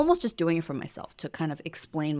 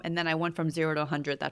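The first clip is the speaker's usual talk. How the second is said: ordinary talk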